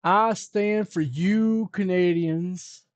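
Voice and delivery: put-on high, slow, drawn-out kid voice; mock young person's slow singsong; kid speak. Shown as a demonstration. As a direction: talk like a whiny youngster. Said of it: "using kid speak today".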